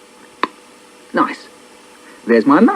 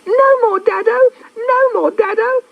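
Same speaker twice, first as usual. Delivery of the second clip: put-on toddler shout. Laura shouting at her dad to stop tickling, high squealing shout. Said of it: "until she shouts".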